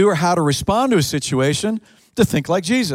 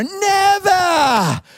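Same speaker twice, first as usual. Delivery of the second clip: put-on Welsh accent shout. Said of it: "with a thick Wales accent".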